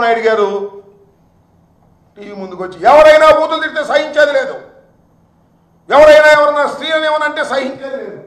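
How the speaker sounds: loud, high indignant shout; angry shouting. Real, not acted. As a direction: shout it out in outrage. Heated political attack speech.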